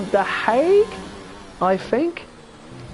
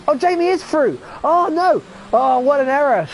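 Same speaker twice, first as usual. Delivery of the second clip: shocked realisation; 8 semitones higher than usual. Real, not acted. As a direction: gasp it out as the error sinks in. Realises he called Jamie out.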